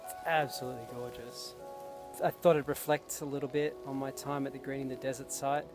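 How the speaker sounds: ordinary talk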